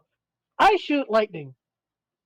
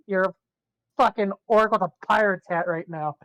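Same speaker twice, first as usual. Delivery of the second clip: normal talking voice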